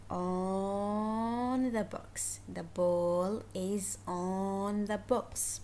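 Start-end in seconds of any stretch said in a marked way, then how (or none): 0.1-2.4 "on" drawn out very long; stress on ON. Shown as a demonstration. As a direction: stretch "on" before finishing the phrase. Teacher stretches the preposition being taught.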